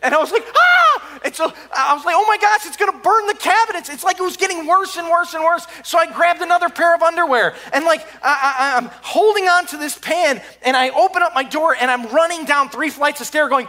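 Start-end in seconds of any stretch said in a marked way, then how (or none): 0.5-1.2 very high, loud scream of fright; shrill scream. Retold or imitated. Flames explode from sink. 2.2-3.9 high, louder alarm. Retold or imitated. Fire spreading toward cabinets. 10.6-13.7 breathless rush. Retold or imitated. Running downstairs shouting.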